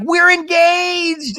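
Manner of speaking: high, loud, drawn-out announcement; mock excited squeal. Imitating Jen announcing her engagement, mock excited squeal. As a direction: stretch it into a loud high whoop. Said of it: "Jen's like".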